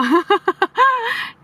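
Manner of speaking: long high-pitched laughter; mocking laugh. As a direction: laugh at the fool. Listeners laugh at nephew. (cười chê)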